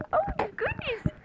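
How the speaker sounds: high, loud startled exclamation; grabbing the mic back in a rush; surprised cry. Real, not acted. Baby grabs the microphone.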